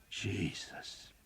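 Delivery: breathless whispered oath. A narrator's reading. Spent soldier after the battle, a whispered oath. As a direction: barely breathe the word out.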